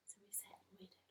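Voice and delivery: hushed whispered narration. A narrator's reading. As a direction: whisper as if hiding in the bushes.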